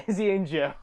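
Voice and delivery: laughing through the names. Real, not acted. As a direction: giggle the names out. Laughing echo.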